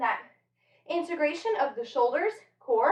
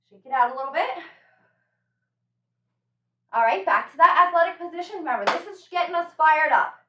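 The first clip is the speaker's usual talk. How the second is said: normal talking voice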